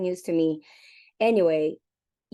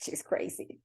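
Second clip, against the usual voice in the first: a secret aside nobody should hear; hushed mock whisper to yourself; 10 dB quieter than usual. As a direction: whisper it under your breath. Aside thought while agreeing to meet.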